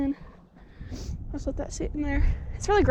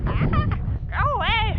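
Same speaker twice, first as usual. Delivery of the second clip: mock alarm; 9 semitones higher than usual. Acting out own past reaction.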